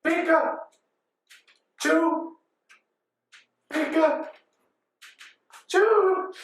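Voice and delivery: high squeak, one part per blow; worn-out Pikachu. Retold or imitated. Exhausted Pikachu punching each other, high halting squeaks. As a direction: squeak each half separately with long gaps. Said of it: "they're, like, punching each other".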